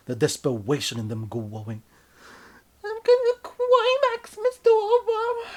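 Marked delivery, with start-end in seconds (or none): 2.8-5.5 high falsetto building to a loud crying shout; climaxing lover. Acted. The lover shouting the name in a rising falsetto. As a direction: build and scream the name at the end. Partner voice.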